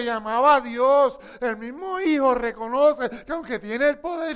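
loud preaching, building; full-force preaching. Real, not acted. Preacher to congregation, loud impassioned preaching. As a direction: preach it out, driving each clause.

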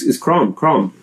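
CROM with an open "aw" vowel; company's own pronunciation. Retold or imitated. Quoting the company saying their own name, open vowel. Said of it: "they have that little all in there".

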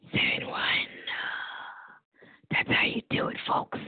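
drops to a soft whisper after reading the number. Real, not acted. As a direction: breathe the sign-off out almost voiceless. Closing out the phone number.

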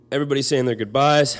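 fighting back tears as you remember the goodbyes; teary-eyed. Real, not acted. Telling how the family said goodbye, close to tears. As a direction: voice rising, choked with real tears. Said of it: "I'm getting a little teary-eyed right now".

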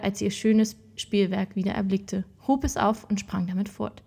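ordinary delivery

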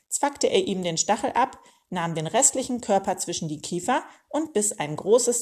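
ordinary delivery, nothing marked

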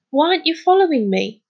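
high, exasperated question; angry. A narrator's reading. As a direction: demand an answer impatiently. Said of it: "he said angrily".

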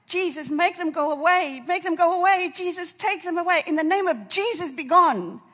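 loud, high cry to Jesus for rescue; desperate shouted prayer. Retold or imitated. Preacher relives begging Jesus to drive them away, desperate shout. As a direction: shout it like commanding evil to flee.

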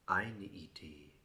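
slow soft whisper. Acted. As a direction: let it fall into a whisper. Whispered line in poem.

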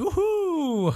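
very high whoop; cheering whoop. Retold or imitated. Lysop cheering for Ruffy, very high whoop. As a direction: whoop with joy.

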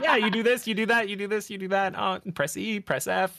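high, eager coaching voice; excited instructing. Retold or imitated. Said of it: "I'm like".

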